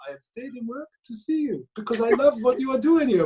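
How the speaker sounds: high-pitched put-on French accent. French desk guy welcoming Welsh fans, in a French accent. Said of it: "do a French accent".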